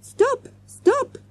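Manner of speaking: very high-pitched panicked cries; crying out. A narrator's reading. Manager stopping children pinning a man, crying out. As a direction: plead in two high yelps. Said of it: "cried the manager".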